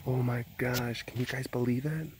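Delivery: hushed pet talk; soft cooing to a pet. Real, not acted. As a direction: coo over the pet up close. Cooing over pet on camera.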